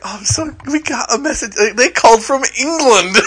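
high-pitched excited retelling; giddy, high-pitched replay of excitement. Retold or imitated. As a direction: gush the news, voice jumping up high. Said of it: "I was just so excited".

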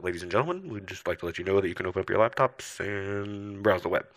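low, even airline announcer voice; flight announcement. Retold or imitated. Crew telling passengers about new internet, announcer voice. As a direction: mimic a cabin crew announcement, pitched low. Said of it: "when they introduced it. They're like".

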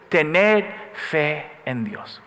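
very slow, each word drawn out; a deliberate slow proclamation; slow emphasis. Shown as a demonstration. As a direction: spell it out slowly for the congregation. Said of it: "Puede decir esa frase conmigo".

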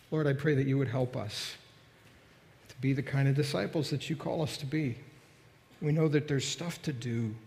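soft, unhurried prayer voice; closing prayer. Acted. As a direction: pray it gently over the congregation. Pastor closes in prayer.

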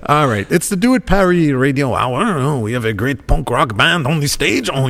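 lightly put-on French announcer; a touch of French Canadian accent. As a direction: introduce the band in a hammy French accent. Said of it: "that's more French Canadian".

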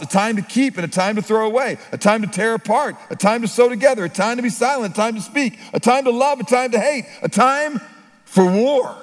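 preaching the verses with mounting force; rising scripture reading. Acted. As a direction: climb with each line of the list.

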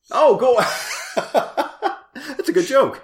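high-pitched laughter through the words; laughing hard. Real, not acted. Laughing at cohost's Eric joke, high and breathless. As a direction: burst out laughing at the pun.

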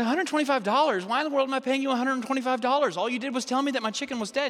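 high, indignant acted protest; mock outrage. Woman disputing vet's bill for dead chicken, indignant. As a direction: throw the price back, incredulous and high-pitched.

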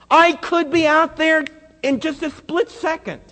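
high, loud pleading cry; impassioned cry. Real, not acted. Preacher pleading to congregation, loud and high. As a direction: cry out for someone to save you.

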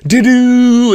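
high, loud sung doo-doo; mock musical sting; sung sound cue. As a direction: chirp a little jingle. Imitating show sound cue.

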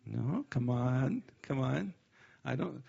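ordinary talk, nothing marked

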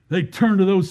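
usual speaking voice

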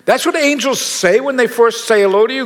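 as if you can't believe your ears; incredulous. Retold or imitated. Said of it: "and I wanna go".

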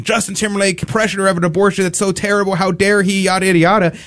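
high, rushed imitation of outrage; mock freaking out. Outraged public over [name], mock freaking out. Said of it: "people are freaking out and saying".

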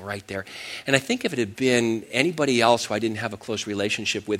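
ordinary talk, nothing marked

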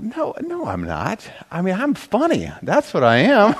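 high-pitched mock protest; protesting denial. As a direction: act out insisting on your innocence, high and incredulous. Acts out his denial to wife.

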